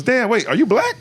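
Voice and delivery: high, surprised double-take; mock surprised Chappelle. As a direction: ask it high with sudden surprise.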